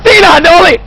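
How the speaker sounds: high, loud cry of fright; startled shout. Real, not acted. Player spotting what was lurking, frightened shout. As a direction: shriek it as they appear. Jump scare in horror game.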